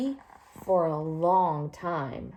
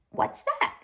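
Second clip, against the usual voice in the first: curious question. Acted. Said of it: "Orson asked".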